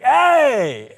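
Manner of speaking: high, loud drawn-out greeting shout; Stan Lee. Retold or imitated. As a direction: holler a big delighted hello, imitating him. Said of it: "he's like".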